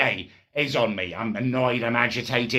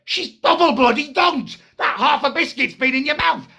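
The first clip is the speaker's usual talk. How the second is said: high-pitched, incredulous rant; loud squeal of comic indignation; comic outrage; 14 semitones higher, 4 dB louder than usual. Acted. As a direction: blurt it out, appalled and indignant. Outraged retelling of dunking.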